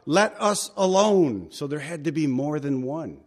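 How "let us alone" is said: demon crying out. Retold or imitated. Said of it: "he cried out".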